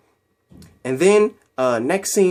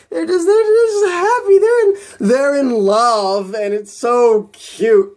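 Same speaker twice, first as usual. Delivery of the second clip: much higher, louder, slow gushing squeal; 14 semitones higher, 8 dB louder, slower than usual. Real, not acted. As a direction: squeal over how cute they are. Gushing over the happy couple.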